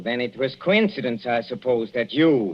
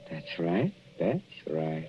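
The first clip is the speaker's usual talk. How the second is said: much softer and lower, calmly menacing; quiet menace; 8 semitones lower, 9 dB quieter than usual. Acted. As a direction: let it sink in, barely above a breath. Husband calmly admits poisoning plan.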